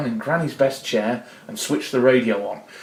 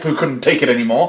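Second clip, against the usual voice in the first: ordinary delivery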